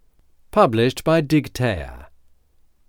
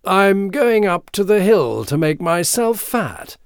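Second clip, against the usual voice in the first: higher, clear middle-goat voice; light, high billy goat; fine, clear goat voice; 8 semitones higher, 4 dB louder than usual. A narrator's reading. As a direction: pipe up finely and clearly. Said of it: "in his fine and clear voice".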